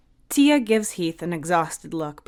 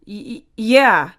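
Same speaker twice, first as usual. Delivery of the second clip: nervous stammer; 4 semitones higher, 8 dB louder than usual. Acted. Heath caught by stern look.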